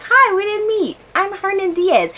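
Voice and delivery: imitating a friendly author. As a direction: play the author greeting her brightly. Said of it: "He was like".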